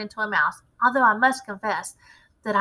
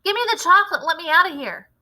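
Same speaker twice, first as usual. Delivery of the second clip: much higher, louder panicked demand; shouting; 8 semitones higher, 6 dB louder, faster than usual. A narrator's reading. Bruno shouts to be let out, rising panic. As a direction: yell to get out. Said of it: "shouted Bruno".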